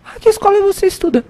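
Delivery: high, mock-sweet question; inquisitive mother. Shop mother asking the boy, high mock-sweet voice. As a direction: imitate a nosy mother questioning a child. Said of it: "Quando ela pergunta".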